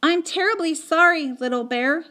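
ordinary delivery, nothing marked

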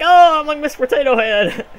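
high, loud goofy put-on voice; mock character voice. Gamer mimicking legless runners, high mocking voice. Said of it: "they're just like".

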